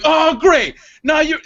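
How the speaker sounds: loud, high-pitched complaint; mock exasperation. Retelling his loud rebuke in a theater, mock exasperated. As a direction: announce the annoyance to the room.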